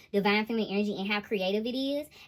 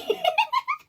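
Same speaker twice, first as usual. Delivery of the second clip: squealing laugh; high burst of laughter; 5 dB louder than usual. Real, not acted. Reader cracking up at her own impression, high laugh.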